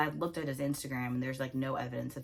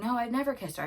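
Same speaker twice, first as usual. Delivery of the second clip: imitating his first denial, raised pitch; retold denial; 7 semitones higher, faster than usual. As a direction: deny it quickly but without fury. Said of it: "And then he's like".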